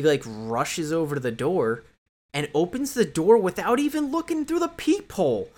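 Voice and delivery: usual speaking voice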